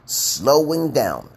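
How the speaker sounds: slow, drawn-out teacher demo; deliberately slowed model phrase. Shown as a demonstration. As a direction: stretch each word out slowly. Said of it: "Learn the basics of slowing down".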